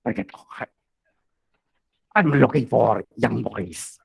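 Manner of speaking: rough, gargling voice; gargled voice. Pretend. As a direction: talk like you're gargling. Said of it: "with the gargled voice".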